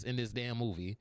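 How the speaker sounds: ordinary delivery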